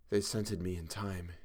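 soft, spent moan; weak moan. A narrator's reading. Chalmers despairing that he was scented, moaning softly. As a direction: moan it under your breath. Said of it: "he moaned".

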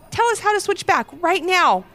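put-on pleading kids. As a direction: beg the shopkeeper. Kids demand.

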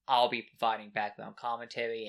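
ordinary talk, nothing marked

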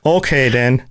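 hammed-up Minnesotan accent. Host chimes in on the ideal agent, mock Minnesotan accent. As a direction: put on a folksy Minnesota accent. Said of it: "like a Minnesotan".

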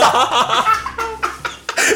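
shrieking laugh, way up high; helpless high cackle; high laughing burst. Real, not acted. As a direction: explode into laughter.

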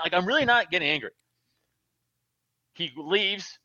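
ordinary talk

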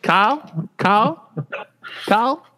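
mock calling.

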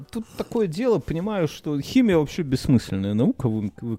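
usual speaking voice

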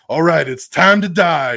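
loud hammed-up Venom threat; mock battle cry. Venom to Carnage before the church fight, loud mock threat. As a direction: announce the fight with relish.

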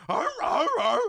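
high, loud seal barks; imitated seal bark.

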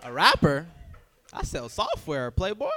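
loud, laughing disbelief; incredulous retort. Retold or imitated. Answers coworkers, loud incredulous scoff. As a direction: scoff at the idea. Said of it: "And I was just like".

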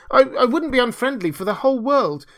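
high, loud, stammered protest; distressed little Hans. Acted. Hans assuring the miller of his friendship, distressed. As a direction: protest loyalty with earnest alarm.

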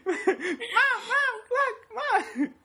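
very high squeaky mock nagging; hammed-up child begging Mom to look; imitating little kid. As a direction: pester like a small kid. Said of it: "You're like that little kid".

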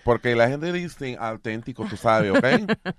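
loud Nuyorican woman sliding into Spanish; Suzanne. Acted. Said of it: "like, Puerto Rican".

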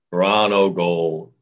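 low, slow, deadpan goal call; downplayed announcement. Shown as a demonstration. Announcer calls the visiting team's goal, downplayed. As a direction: announce it with no excitement, dropped low. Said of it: "style is such that you downplay".

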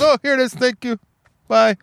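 high, sing-song clerk; high chirpy thank-you and bye; clerk's voice. Retold or imitated. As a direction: imitate the postal clerk brightly. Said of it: "And she goes".

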